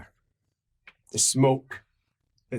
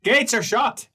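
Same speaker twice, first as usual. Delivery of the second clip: high, loud guard laying down the rules; guard on the wall telling the party the gates are shut, loud; 10 semitones higher, 5 dB louder than usual. Acted. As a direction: announce it from the wall like a smug guard. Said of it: "He says".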